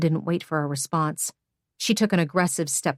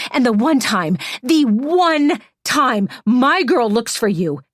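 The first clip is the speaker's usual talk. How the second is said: louder and higher, each question hammered harder; furious rant; 5 semitones higher, 4 dB louder than usual. Acted. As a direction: pile on the accusations, building to a high shout. Hannah's rising list of Jonah's wrongs.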